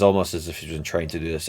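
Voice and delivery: ordinary delivery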